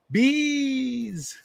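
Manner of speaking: imitating a movie scream; mock bees shriek. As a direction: throw out a hammed-up panicked cry. Shrieking the bees quote again.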